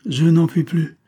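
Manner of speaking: high, loud groan of exhaustion; groaning complaint. A narrator's reading. Exhausted lieutenant to Émilie after a morning in the sun, groaning. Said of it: "répondit Yergounov en gémissant".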